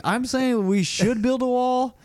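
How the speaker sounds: high, slow, emphatic comedian imitation; Tom Segura. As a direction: draw it out like a stand-up punchline. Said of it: "he's like".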